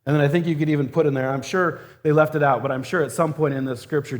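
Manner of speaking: ordinary talk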